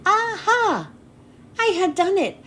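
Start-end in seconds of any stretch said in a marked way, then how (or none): 0.1-0.9 triumphant aha. Acted. Triumph after poem praised.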